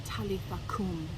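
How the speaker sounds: put-on gentle words to a little girl; gently. A narrator's reading. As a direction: coax a sleeping child awake. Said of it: "and he says gently".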